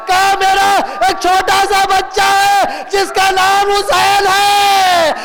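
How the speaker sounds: drawn-out, sustained mourning narration; full-force majlis oration with stretched endings; impassioned lament preaching. Real, not acted. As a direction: chant the tragedy out to the crowd.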